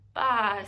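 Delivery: put-on a playful baa for the kids; lamb bleat. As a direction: bleat like a lost little lamb.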